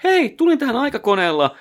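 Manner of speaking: high-pitched cheery greeting; eager high-voiced visitor greeting grandma; excited time traveller. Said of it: "ja oot silleen".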